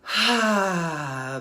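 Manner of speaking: big voiced sigh of release; loud release sigh. Shown as a demonstration. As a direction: let it out with a full loud sigh. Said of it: "Making noise if that feels good".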